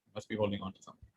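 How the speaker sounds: hushed quick echo of the teacher's line; soft echo. Retold or imitated. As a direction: echo it quietly. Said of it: "he always said the same thing".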